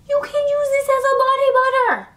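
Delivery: very high, sustained mock-squeal; high squeaky voice. Vlogger revealing a candle doubles as body butter, high squeaky voice.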